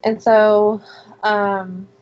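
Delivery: ordinary talk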